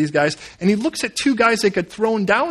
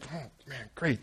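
soft, resigned sigh of a line; Job's weary dismay; 3 semitones lower, 16 dB quieter than usual. Acted. Job learning God has singled him out, weary dismay. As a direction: let it out under the breath, deflated. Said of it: "Job's like".

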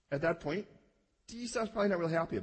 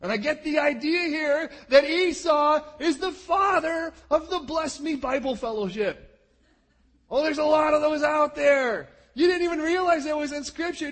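high, loud sarcastic preaching; mocking preacher; 10 semitones higher, 7 dB louder than usual. Real, not acted. Preacher teasing the congregation about bless-me churches, loud and sarcastic. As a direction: jab at the bless-me crowd.

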